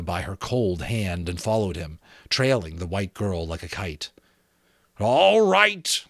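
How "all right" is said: high, loud, regretful skull; sorrowful skull voice; sad resignation. A narrator's reading. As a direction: give in regretfully, pitched up. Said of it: "the skull said sadly".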